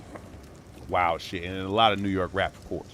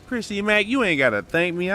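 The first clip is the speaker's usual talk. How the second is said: excited greeting; 8 semitones higher, 4 dB louder than usual. Real, not acted. Greeting a famous raider.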